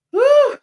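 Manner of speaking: loud, high-pitched "whew"; exasperated "whew". Real, not acted. Reviewer venting about Doctor Simone, exasperated whew. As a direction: blow off steam in one high whoop. Venting after getting mad.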